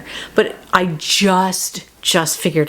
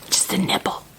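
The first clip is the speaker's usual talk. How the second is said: whispered nibble; 7 dB quieter than usual. Acted. Child nibbling house, whispered.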